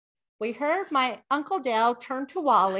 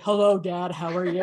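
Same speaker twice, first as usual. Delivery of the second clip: deep voice for dad; 7 semitones lower, 4 dB louder than usual. Shown as a demonstration. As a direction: drop the voice low so a deaf dad hears. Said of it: "if I made my voice deep like this".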